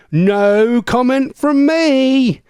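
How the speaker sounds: very slow, high, stretched-out playful dodge; exaggerated mock diplomacy. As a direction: stretch every word with hammed-up innocence. Repeats the joke dodge, stretched further.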